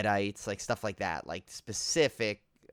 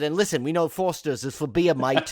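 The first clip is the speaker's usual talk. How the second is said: mock Aussie; 5 semitones higher, 6 dB louder than usual. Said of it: "Should have just said".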